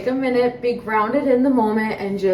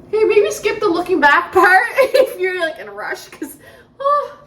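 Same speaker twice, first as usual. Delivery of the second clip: laugh-crying; 7 semitones higher, 5 dB louder than usual. Real, not acted. Tearing up while laughing.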